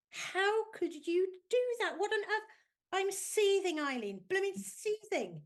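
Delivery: high, loud, slow indignant outburst; seething outrage. Acted. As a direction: play a young woman who is fuming at her friend. Ness furious at Eileen's prank.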